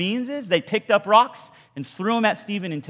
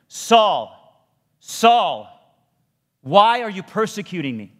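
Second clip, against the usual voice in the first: a calling voice from heaven, a bit higher and louder; 3 semitones higher, 4 dB louder, slower than usual. Acted. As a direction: call out like the Lord from above.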